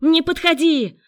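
high, loud cry to keep away; shrieked defensive order; frantic warning. A narrator's reading. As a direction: scream a warning at a pursuer. Girl warns off pursuer.

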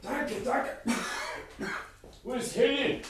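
high, strained, choking on smoke; short of breath. Acted. Old professor choking on smoke, strained and gasping. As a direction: gasp the words out through the smoke.

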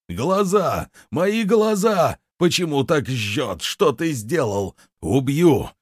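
high, stretched cries of agony; screaming in pain. A narrator's reading. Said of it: "сразу же заорал". (вопль боли и ярости)

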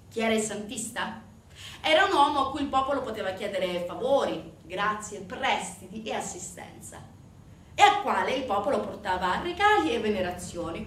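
plain reading voice

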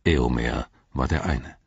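ordinary delivery, nothing marked

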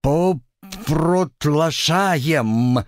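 bleating slightly slurred words; slurring drunk. Acted. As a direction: slur it out like a tipsy goat. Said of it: "чуть заплетающимся языком проблеял".

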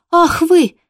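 high, loud cry of exasperation; heated exclamation. Acted. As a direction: throw up your hands at the dogs. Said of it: "в сердцах воскликнула ма".

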